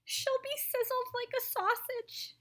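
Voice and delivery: high, fussy mother's voice; a lightly voiced, fretting rich mother; fretful mother. A narrator's reading. Mrs Salt fretting about daughter.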